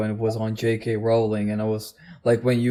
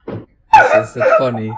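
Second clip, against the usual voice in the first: very high, loud falsetto squeak; squeaky mock question; 9 dB louder than usual. Real, not acted. Teasing friend who laughs, in a very high loud squeak.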